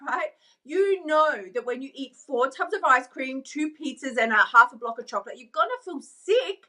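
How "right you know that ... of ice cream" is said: ordinary talk, nothing marked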